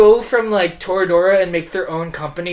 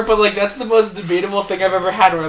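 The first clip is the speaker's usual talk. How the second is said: ordinary talk, nothing marked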